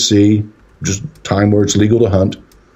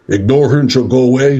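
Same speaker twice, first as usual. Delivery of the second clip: lightly imitated officer's order, higher pitch; a hint of an authoritative cop; police officer impression; 4 semitones higher than usual. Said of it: "like he's a police officer or something".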